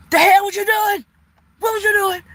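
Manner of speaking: high, loud scolding auntie; scolding shout. Acted. Auntie yelling at the viewer for misbehaving, loud high scold. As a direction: yell it like an auntie catching a kid misbehaving.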